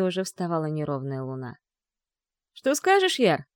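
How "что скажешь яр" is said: butting in. Acted. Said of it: "встрял Лис".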